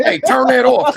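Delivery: high, loud whining mock plea; mock whiny order. Trip fed up with his car, whiny mock order. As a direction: whine at the car to shut off. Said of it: "you hear Trip arguing".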